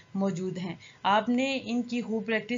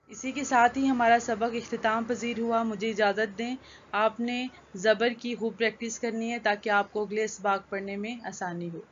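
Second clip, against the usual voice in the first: usual speaking voice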